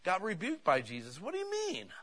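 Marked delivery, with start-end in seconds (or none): 1.2-1.9 very high-pitched imitated bafflement; mock protest. Disciples rebuked by Jesus, mock high-pitched protest.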